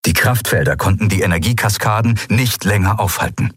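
ordinary delivery, nothing marked

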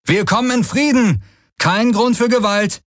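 high, carrying call of an approaching rider; calling out to strangers. Acted. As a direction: shout a peace offer across the sky.

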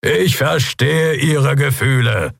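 high, slow public address. A narrator's reading. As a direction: project it slowly over a crowd.

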